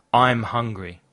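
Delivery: usual speaking voice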